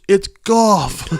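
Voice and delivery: high, loud blurted shout; shouted answer. Retold or imitated. Guy in background answers.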